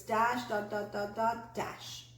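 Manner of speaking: long "dash", short even "dot, dot, dot, dot"; Morse code rhythm. Shown as a demonstration. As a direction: stretch each "dash", tick through the "dot"s. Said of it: "So these are your dashes".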